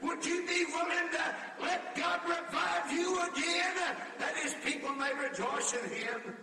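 shouted, high altar call, half-sung; full-force preaching. Acted. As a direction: plead with the whole congregation, full voice.

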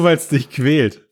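normal talking voice

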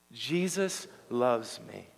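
slow, deliberate declaration to be repeated; slow affirmation. Shown as a demonstration. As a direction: lead the congregation word by word. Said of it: "One more time".

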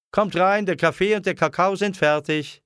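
put-on high-pitched summons to the children; calling out. A narrator's reading. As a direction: call them in from the garden. Mother calls children in from garden. (hell rufende Mutter)

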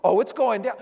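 loud, high rush of adrenaline; pumped-up bravado. Retold or imitated. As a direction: say it like the fight is on. Adrenaline pumping before a fight.

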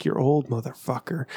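slightly mocking low inner voice. Own head teasing him about a pulled muscle, low mock taunt. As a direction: drop low for a lightly voiced inner heckler. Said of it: "my inside voice is like".

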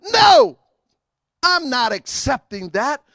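a sudden shouted, high no; preacher's shouted refusal; forceful no. Real, not acted. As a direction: reject it with a shout. Rebuking sickness declaration.

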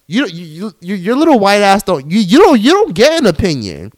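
high, loud stuttering fury; real furious sputtering; angry outburst. Real, not acted. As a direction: sputter with rage. Ranting at Catherine.

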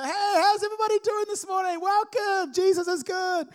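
high, loud, bright-faced stage greeting; mock-chirpy welcome as if nothing is wrong; fake cheery worship leader. Pastor mocking his own upbeat stage welcome while still angry, hammed-up cheer. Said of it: "I can't get up and go".

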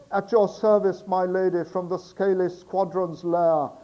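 put-on loud, bellowing old sailor; Barnacle Bill voice. Sailor outside the door bellows to the girl, loud sailor shout. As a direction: shout it up from the street like a rough seaman. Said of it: "the Barnacle Bill voice for Barnacle Bill".

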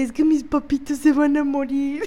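nervous laughter through the words. Real, not acted. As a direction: laugh nervously while quoting. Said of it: "me río de nervios".

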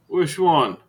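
a doorman mocking the common name; sarcastic doorman.

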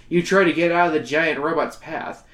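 normal talking voice

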